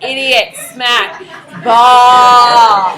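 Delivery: very loud, joking scolding; mock exasperated teacher. Teacher jokingly correcting a kid's B, loud mock scolding. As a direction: boom the correction in mock outrage. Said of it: "and you're like".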